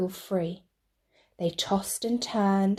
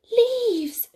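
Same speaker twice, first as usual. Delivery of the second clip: much higher delighted exclamation; excited porcupine; 5 dB louder than usual. Acted. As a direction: pounce on the find. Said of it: "said a porcupine".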